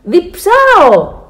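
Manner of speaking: high, loud, drawn-out yell; child shouting. A narrator's reading. Alfons tells Dad he is thirsty, shouting. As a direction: stretch out a thirsty wail. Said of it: "φωνάζει".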